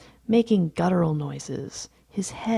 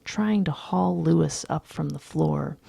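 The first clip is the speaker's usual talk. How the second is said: plain reading voice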